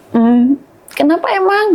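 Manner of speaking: lightly acted motherly question; retold mother's question. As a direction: act out asking a child why.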